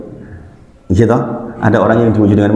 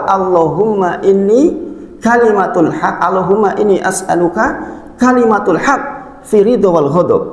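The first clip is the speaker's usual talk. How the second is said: higher, slow chanted Arabic prayer; prayer recitation; 9 semitones higher than usual. Acted. Preacher reciting the Prophet's prayer to congregation, chanted. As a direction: intone the prayer devoutly.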